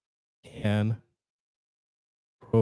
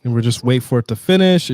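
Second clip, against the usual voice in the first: ordinary talk, nothing marked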